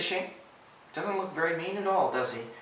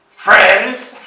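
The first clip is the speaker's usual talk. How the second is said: loud, incredulous jeer; scoffing goat; 5 semitones higher, 17 dB louder than usual. Acted. Ruff mocking ogre's offer of friendship, loud scoff. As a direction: fling it back with a barking laugh.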